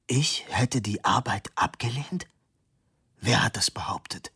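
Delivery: voiceless, breathed disbelief; horrified whisper. Acted. Stunned professor asks who made the claim, hushed whisper. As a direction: whisper it as if the floor gave way.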